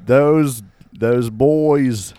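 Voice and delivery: high, hammed-up Aussie bloke voice; Australian accent. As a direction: play a cheery Aussie ocker. Australian Eye character greets boys.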